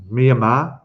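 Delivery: Myanmar said as MEE-uh-mah; pronounced MEE-a-mar. Shown as a demonstration. Streamer showing viewers the country's name, Myanmar as MEE-uh-mah. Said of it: "I think it's pronounced".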